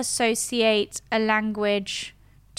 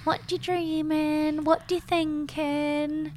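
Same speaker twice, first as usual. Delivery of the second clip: higher, drawn-out singsong questions; mock curious questioners; 6 semitones higher, slower than usual. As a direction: imitate people asking the same old question. Mimicking people's repeated question.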